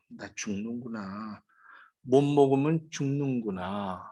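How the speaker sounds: hushed, slow 나 죽는구나, leaning on 못; quiet despair. Acted. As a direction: breathe 죽는구나 out almost spent, then press 못. Voicing the man facing death.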